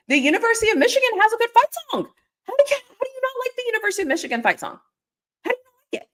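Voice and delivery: high, fast gushing; excited. Real, not acted. Raving to viewers about the Michigan fight song, excited. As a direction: rave about the song in disbelief.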